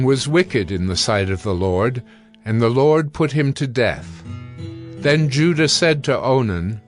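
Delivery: ordinary talk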